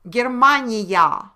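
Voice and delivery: slow proud stretching of Germania; drawn-out old form. Shown as a demonstration. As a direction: linger on Germania to show the full ending. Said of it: "Say it was Germania".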